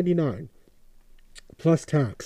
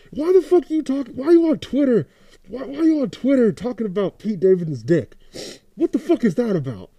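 high, loud incredulous questioning; exasperated confrontation; 7 semitones higher, 5 dB louder than usual. Acted. Fan confronting [name] about his tweets, exasperated and high. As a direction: challenge him in a raised, baffled voice.

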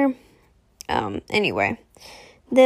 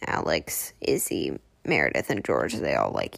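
ordinary talk, nothing marked

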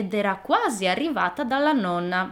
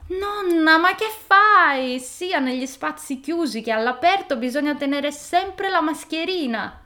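put-on shocked scolding girl; 8 semitones higher, 5 dB louder than usual. Girl scolding unmasked wolf.

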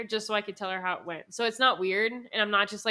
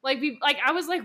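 usual speaking voice

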